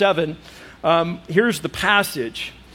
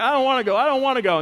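higher-pitched, mock whiny protest; reluctant whining; 5 semitones higher, much faster than usual. Churchgoer before a service, mock reluctant whining. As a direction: act out a reluctant complaint, pitched up.

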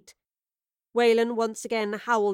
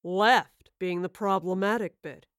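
taunting; 2 semitones lower, slower than usual. A narrator's reading. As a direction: needle her with slow scorn. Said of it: "he taunted".

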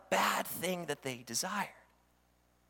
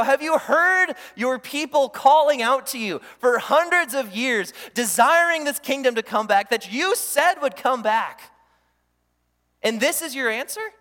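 frustrated outcry; 5 semitones higher, 8 dB louder than usual. Retold or imitated. Disciples confront Jesus, frustrated.

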